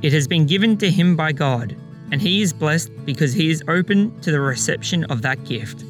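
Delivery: usual speaking voice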